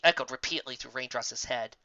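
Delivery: plain reading voice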